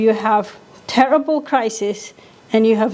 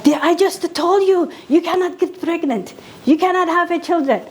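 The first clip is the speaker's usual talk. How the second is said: lecturing; 7 semitones higher than usual. Retold or imitated. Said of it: "I lectured her".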